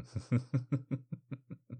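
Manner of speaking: long, light chuckle; teasing laugh. Acted. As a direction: chuckle knowingly at a lover. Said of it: "Why am I laughing?".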